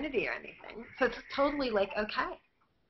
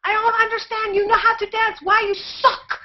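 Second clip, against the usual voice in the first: high, loud voice of an exasperated teacher; imitating the priest; 10 semitones higher, 6 dB louder than usual. The Tai Chi priest scolding his student, a high mock scold. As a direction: mimic the old master scolding his student. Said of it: "he could be go".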